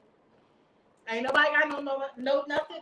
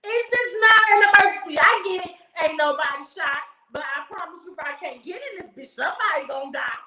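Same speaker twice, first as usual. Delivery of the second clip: shouting, furious, at the 911 operator; imagined angry rant; 8 dB louder than usual. Pretend. Caller yelling at the operator about her locked apartment, furious shout. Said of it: "That's what I should have said".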